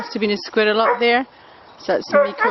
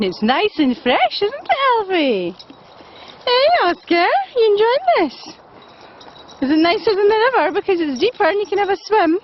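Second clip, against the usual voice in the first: high, sing-song voice for the dogs; doggy talk; 10 semitones higher than usual. Real, not acted. Owner chatting to her dogs on a walk, high fond voice.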